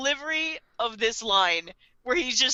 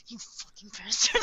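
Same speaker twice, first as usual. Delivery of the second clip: acted seething curse, kept contained; mad mutter; 3 dB quieter than usual. Light reacts to L's threat, mock seething. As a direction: curse him under your breath, mock-furious. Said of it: "he's so mad".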